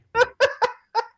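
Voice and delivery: very high, loud cackle; real laughing fit. Real, not acted. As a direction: burst into a high-pitched laugh. Cracks up after own outburst.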